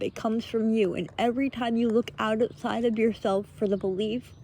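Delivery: ordinary delivery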